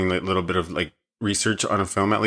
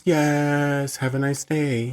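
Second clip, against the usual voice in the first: cheering; 7 semitones higher, much slower than usual. Retold or imitated. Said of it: "Everybody screams".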